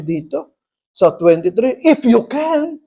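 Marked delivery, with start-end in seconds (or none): 1.8-2.8 high, affronted echo; offended Lord. Retold or imitated. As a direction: throw it back as if affronted. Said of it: "Parang na-offend si Lord eh". (parang na-offend, mataas ang tono)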